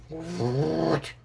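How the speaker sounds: sudden high startled "What?"; shocked. Acted. Mother caught off guard by what Naruto shows her, shocked. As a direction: jump up in pitch in disbelief.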